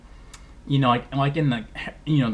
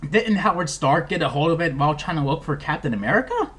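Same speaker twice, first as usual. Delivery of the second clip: ordinary delivery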